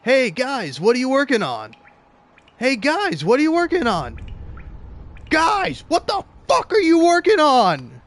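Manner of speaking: greeting that escalates to a furious shout; building exasperated yell. Retold or imitated. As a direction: start with a friendly call, then lose it. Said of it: "and he's like".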